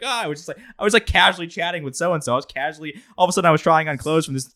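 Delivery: teasing imitation, high and airy; imitating breezy friend. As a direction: lightly mimic a friend casually name-dropping. Imitates her casual celebrity stories.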